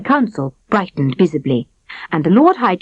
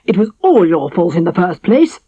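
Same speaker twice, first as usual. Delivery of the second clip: cross king; 2 semitones higher, 3 dB louder than usual. A narrator's reading. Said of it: "getting crosser than ever".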